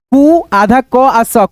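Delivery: "कु... आधा क... स" slowly, sound by sound; broken into sounds. Shown as a demonstration. Teacher sounds out cooks for learners, slowly in pieces. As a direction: take "कु आधा क आ स" apart. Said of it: "क्या पढ़ेंगे".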